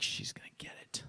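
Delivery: determined whisper. Retold or imitated. Boy psyching himself up.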